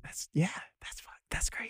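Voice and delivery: whispered disbelief, very soft; stunned whisper. Real, not acted. Guest reacting to how near twenty sixty is, stunned whisper. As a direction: whisper it, as if your mind is blown.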